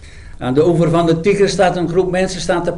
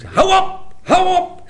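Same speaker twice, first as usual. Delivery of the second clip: shouting. Acted. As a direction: yell at them to stop. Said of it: "Isha schreeuwt".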